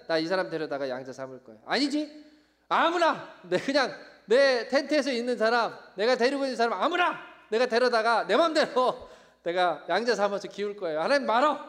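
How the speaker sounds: loud, high, stubborn protest; hammed-up defiant outburst; defiant retort. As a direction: act out digging in against God. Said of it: "쏘아붙이는, 항변하는 장면".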